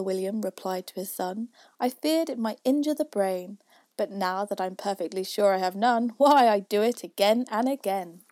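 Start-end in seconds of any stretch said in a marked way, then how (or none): 1.8-8.2 put-on louder boastful old man. A narrator's reading. Father William loud.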